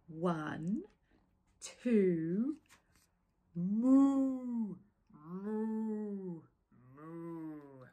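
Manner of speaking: counting turning into long moos, fading; cows mooing. Acted. The cows trying to count, mooing instead. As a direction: start counting, then moo away.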